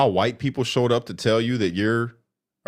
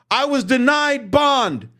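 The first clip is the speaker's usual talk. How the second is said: mock whining; 11 semitones higher, slower than usual. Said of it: "you were crying".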